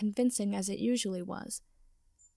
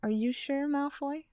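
teasing question, lilting upward; grinning; 5 semitones higher than usual. A narrator's reading. Granger needling Draco about his trapped hand, grinning. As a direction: ask it with an audible grin.

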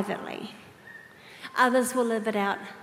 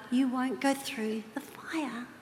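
hushed, slow promise; whispered promise; 7 dB quieter, slower than usual. Retold or imitated. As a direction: whisper it slowly, like a vow.

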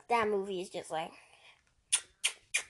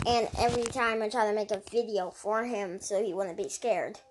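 ordinary talk, nothing marked

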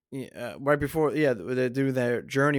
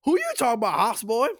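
high, indignant comeback; hammed-up challenge, pitched way up; movie character impression; 11 semitones higher, faster than usual. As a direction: fire back in a high put-on voice. Acts out film character's retort.

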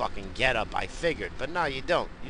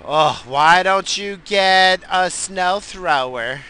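loud, high, drawn-out mimicry; mocking nag; 7 semitones higher, 9 dB louder, much slower than usual. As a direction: parody naysayers with a slow, loud whine. Mimics people advising him.

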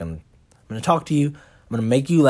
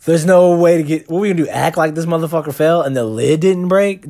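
panicked; 5 semitones higher, 6 dB louder than usual. Retold or imitated. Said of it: "'Cause I was like".

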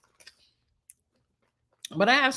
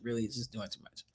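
low, soft, dismissive voice; low mocking aside; 8 semitones lower, 14 dB quieter, faster than usual. Shown as a demonstration. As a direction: drop it low and mutter.

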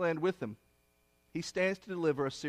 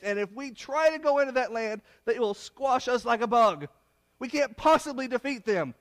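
mock scared scouts; 6 semitones higher, 4 dB louder than usual. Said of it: "And ten of them were scared".

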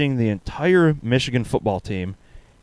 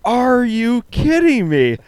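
slow, high-pitched incredulity; retold wail of disbelief; mock outraged disbelief; 12 semitones higher, 4 dB louder, slower than usual. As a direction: stretch it out high, acting out exasperation. Retold outraged reaction to the text.

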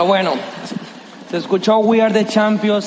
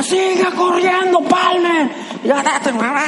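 coach yelling; 10 semitones higher, slower than usual. Retold or imitated. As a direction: bark it like a coach from the stands.

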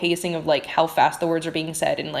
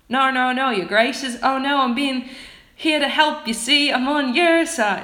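higher, louder botched Cockney; attempted Cockney accent; 7 semitones higher, 6 dB louder than usual. Reader voicing the hunchback to the troopers, in shaky attempted Cockney. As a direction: try a Cockney accent, pitched up and loud. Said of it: "I'm gonna try for Cockney".

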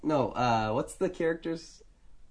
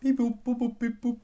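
high beeping dial tones; phone dial beeps; 11 semitones higher than usual. Pretend. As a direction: sing the keypad tones. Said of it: "let me dial him up".

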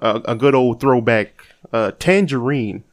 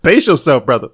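laughing tease; 9 semitones higher than usual. Real, not acted. Teasing the ranting cohost.

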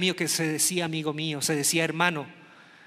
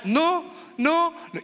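put-on girl refusing; 9 semitones higher, much slower than usual. Girl rejecting boy.

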